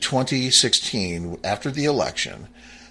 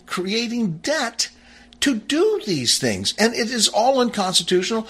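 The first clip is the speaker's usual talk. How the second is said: high-pitched, insistent declaring; emphatic indignation; 7 semitones higher than usual. Real, not acted. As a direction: press every point home in a raised pitch. Emphatic reading, then indignant accusation.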